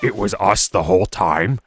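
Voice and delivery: slow, lightly voiced orc realising; Thrall voice. Thrall in the astronaut meme, slow and lightly voiced. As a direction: draw it out like a dawning revelation. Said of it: "He's like".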